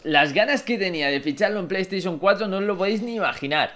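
usual speaking voice

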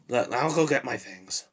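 normal talking voice

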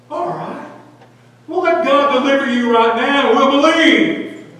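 loud, high-pitched taunt; heckler's full-volume jeer; mocking shout. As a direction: shout it up at him as a dare. Mockers shouting at crucified Jesus.

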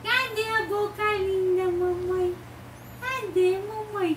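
high sing-song motherese. Real, not acted. Mother cooing at baby.

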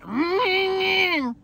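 long, high engine whine; imitated engine rev. Loving a sexy car, imitated high engine rev. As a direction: rev up and hold it like a motor.

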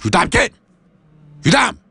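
scolding yell. Acted. Master scolding pupil.

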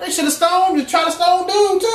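high, loud preaching peak, pushing hard; full-force preaching. Acted. Preacher to his congregation, full-force loud preaching. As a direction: preach it out at the top of the voice.

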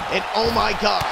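normal talking voice

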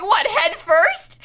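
slow, high, loud question through laughter; laughing question. Retold or imitated. Asking sister in car, laughing incredulous. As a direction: drag it out while laughing. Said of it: "And I was like".

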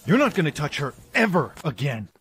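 loud, high, protective shout; angry warning. Acted. John defending Maya from his boss, angry warning. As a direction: shout it as a furious threat.

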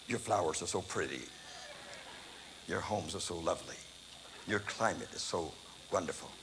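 mock flattery. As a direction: pour on the fake charm, low and soft. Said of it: "it's a lot of baloney".

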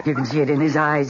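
usual speaking voice

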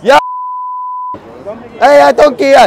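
high, loud indignant yelling; worked-up, high-pitched tirade; outraged shouting. Pretend. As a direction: hurl insults in a huff. Outraged insults after being sworn at.

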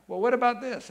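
high, loud mocking challenge; a heckler's loud high comeback. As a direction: throw the question out loud and high, pushing back. Crowd arguing with Jesus.

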